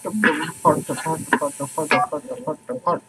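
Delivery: trailing off into a soft, low echo; playful echo fading out. Pretend. Repeats phrase like fading echo.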